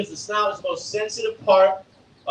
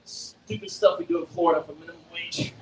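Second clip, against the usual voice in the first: normal talking voice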